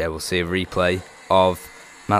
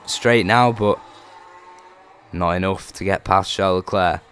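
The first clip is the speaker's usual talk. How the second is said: normal talking voice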